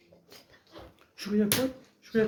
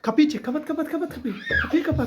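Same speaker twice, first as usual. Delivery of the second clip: shouting; 6 semitones higher than usual. Pretend. Monster coming, shut the door.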